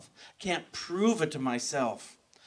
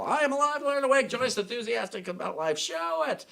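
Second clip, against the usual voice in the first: retold yell; 9 semitones higher than usual. Teacher yelling at her class to show enthusiasm, high pitched retold yell. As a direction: bark it at the class like a drill sergeant. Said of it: "but she would yell".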